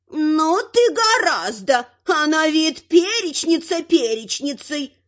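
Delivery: slow, high-pitched she-troll; marvelling troll woman. A narrator's reading. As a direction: draw it out in grudging wonder.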